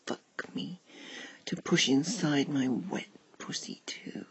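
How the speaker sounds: plain reading voice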